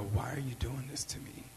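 whispered plea, barely audible; very soft voice. Retold or imitated. Retold woman whispering softly to her attacker. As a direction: breathe it out gently, no force. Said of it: "In a very soft voice".

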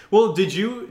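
ordinary talk, nothing marked

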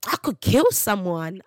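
loud, heated exclamation; loud acted rage; angry outburst. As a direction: burst out as if seething. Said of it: "You know the anger that you think".

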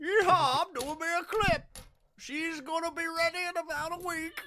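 high, squeaky mock American; squeaky American accent. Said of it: "He's too squeaky".

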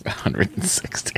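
whispering. Real, not acted. Muttered aside during mental math.

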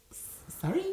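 high stammered question; retold shocked query. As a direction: stumble into a high, disbelieving question.

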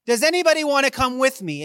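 a preacher's booming invitation to the mountain; Jesus calling out. Retold or imitated. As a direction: hail the disciples from a distance. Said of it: "but, but Jesus says".

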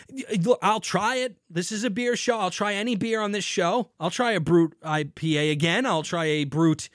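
usual speaking voice

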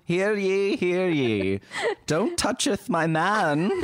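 put-on high, old-fashioned proclamation voice; town crier. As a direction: announce it like a herald reading a scroll. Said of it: "unfurl a big scroll".

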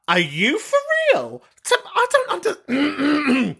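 high-pitched disbelief breaking off in disgust; mock disgust. Real, not acted. Host reacting to sausage with tomato, disgusted disbelief. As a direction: splutter in appalled comic bafflement. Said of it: "that's just ectoplasm from the disgust".

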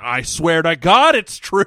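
loud, slow mock insistence; drawn-out mock-earnest protest; insistent protest. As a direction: swear it emphatically, imitating a fibber. Retold insistent denial.

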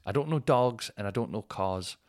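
ordinary delivery

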